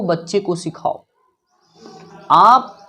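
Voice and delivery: usual speaking voice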